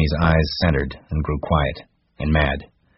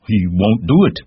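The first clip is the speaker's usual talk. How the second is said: Lenny crying out, louder and higher; cried out; 6 semitones higher, 7 dB louder than usual. A narrator's reading. Lenny protesting to Crooks, loud and high. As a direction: protest loudly that George won't. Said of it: "Lenny cried".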